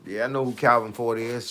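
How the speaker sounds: ordinary talk, nothing marked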